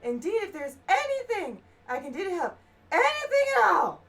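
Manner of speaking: character's normal voice. Acted. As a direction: voice the grateful scholar up high. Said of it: "I'm gonna kill my voice".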